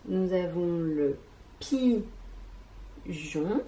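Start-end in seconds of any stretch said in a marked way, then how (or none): 1.6-3.6 "pigeon" as pi... geon, rising at the end; drawn-out "pi", long pause, then questioning "geon"; split into syllables. Shown as a demonstration. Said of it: "des syllabes qui composent".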